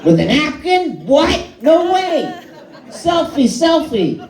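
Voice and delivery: very high, squealing, laughing delight; overjoyed Fallon. Retold or imitated. Comic mocking Fallon at breakfast, squealing with joy. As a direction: gush over everything like a thrilled child. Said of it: "he must wake up ... and go".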